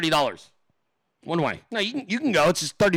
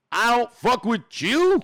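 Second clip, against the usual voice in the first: slow, high, punched-out rejection; emphatic brush-off; 5 semitones higher, 4 dB louder, slower than usual. Acted. Host rejects someone he dislikes, slow emphatic brush-off. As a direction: spell out the rejection slowly, voice rising.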